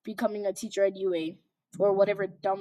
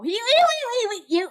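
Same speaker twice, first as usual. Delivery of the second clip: mocking giggle; 14 semitones higher, 4 dB louder than usual. Real, not acted.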